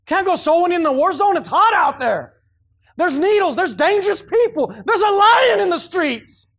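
high, loud, slow mocking whine of a lazy excuse; shrill-free mock whine, high and loud; whiny excuse-maker. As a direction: ham up the lazy man's excuse. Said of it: "That's what they're saying".

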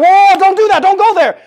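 high, loud sarcastic mock panic; mock alarm. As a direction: ham up an overblown freak-out at a warning. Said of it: "we're like".

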